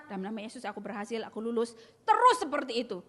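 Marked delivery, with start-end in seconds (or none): 0.1-1.7 a quick, soft murmur under the breath; muttered to herself. Retold or imitated. Lecturer retells her muttering a vow, soft and quick. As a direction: murmur the vow fast and low, hoping.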